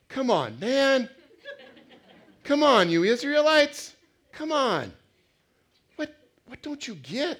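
loud, high-pitched exasperated outburst; mock-cry out at people who just won't get it; fed up. Preacher as God scolding the Israelites, hammed-up exasperation. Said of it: "God's sick of it".

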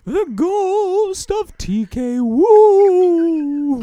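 high, wailing ghostly voice. Acted. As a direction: moan it like a ghost from beyond. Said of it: "a ghostly voice is coming down".

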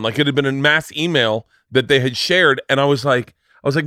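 usual speaking voice

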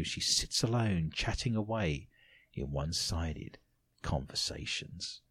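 low, very soft ghost-story telling; creepy hush. A narrator's reading. Host reads of child chatting to ghost, eerie hush. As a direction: let it sink to an eerie hush.

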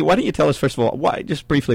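usual speaking voice

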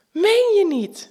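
high and loud, disbelieving; surprised exclamation. Acted. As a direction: react to his birthday in disbelief. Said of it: "roep ik".

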